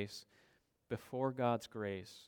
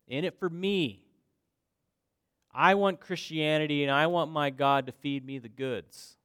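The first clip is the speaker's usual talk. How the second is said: loud, raised, entitled list of demands; mock demanding believer; 4 semitones higher, 10 dB louder than usual. Preacher mimicking self-serving believers, mock demanding. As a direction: demand it like a selfish follower.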